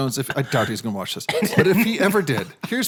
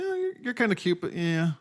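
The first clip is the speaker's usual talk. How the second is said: lukewarm interest; 7 semitones higher, 4 dB quieter than usual. Retold or imitated. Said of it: "there's this girl who's like".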